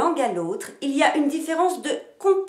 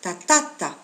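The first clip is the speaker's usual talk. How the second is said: ta TA ta, weak outer syllables; middle stress; 4 semitones lower, 3 dB louder than usual. Shown as a demonstration. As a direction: punch the middle beat of ta ta ta. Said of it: "il peut tomber au milieu d'une séquence".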